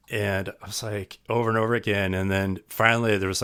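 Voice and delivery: normal talking voice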